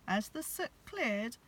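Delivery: ordinary delivery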